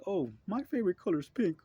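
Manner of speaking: high, sing-song mock voice of one side; mock partisan. Mocking one political side with a high, airy voice. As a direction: toss off a silly preference. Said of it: "is like saying".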